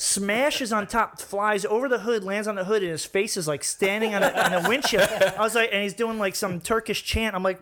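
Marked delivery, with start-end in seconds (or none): none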